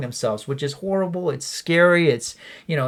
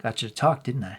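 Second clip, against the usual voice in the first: Mark Wahlberg line reading; 3 semitones lower, 4 dB quieter than usual. Acted. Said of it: "you're gonna play the part of Elliot Moore".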